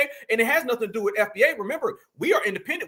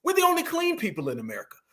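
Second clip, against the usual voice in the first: brisk, higher-pitched restatement; quicker emphatic repeat; 4 semitones higher, 3 dB quieter, faster than usual. Real, not acted. Repeating the claim for listeners, brisk and emphatic. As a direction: say it in one quick run. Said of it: "Let me say that one more time".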